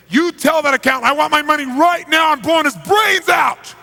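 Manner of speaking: screaming. Retold or imitated. Mafia boss with a gun screaming at the interpreter. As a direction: scream the threat, slowing on the last words. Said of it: "started screaming".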